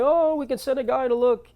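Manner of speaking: high, chirpy fake-helpful rep voice; mock customer service voice. Con Ed rep on the phone, mock cheerful service voice. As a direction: play the breezy phone rep brushing him off. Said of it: "they're like".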